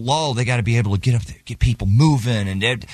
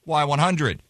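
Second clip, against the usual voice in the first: ordinary talk, nothing marked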